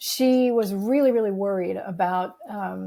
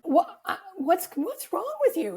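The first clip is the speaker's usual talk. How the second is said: high-pitched incredulous inner rant; exasperated; 8 semitones higher than usual. Retold or imitated. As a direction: act out baffled parental exasperation.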